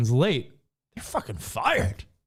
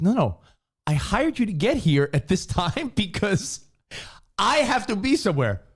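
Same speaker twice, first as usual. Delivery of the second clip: higher-pitched, indignant quote; mock-exasperated, pitched up; exasperated retold parent; 7 semitones higher than usual. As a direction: imitate a parent telling off a late sitter. Retold parent scolding late babysitter.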